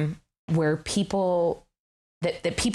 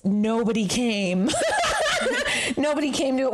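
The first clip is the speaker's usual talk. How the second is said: laughing; 7 semitones higher than usual. Real, not acted. Laughing at failed show.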